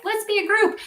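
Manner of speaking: high-pitched eager little cheer; eager joiner. Acted. Someone keen on teaming up, eager and high-pitched. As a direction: squeal it as an eager team player.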